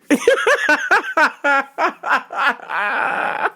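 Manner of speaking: long high cackling fit; big rolling belly laugh; helpless laughter. Real, not acted. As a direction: laugh until breathless. Cracks up at own impression.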